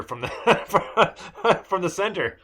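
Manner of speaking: breaking into a real laugh mid-line; can't hold the laugh while quoting the joke; laughing through quote. Real, not acted. Retelling Cisco's best line to the co-host, laughing through it.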